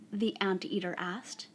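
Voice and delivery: unhurried picture-book reading, softly spoken; story read to children. A narrator's reading. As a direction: draw out each phrase gently, leaving long pauses.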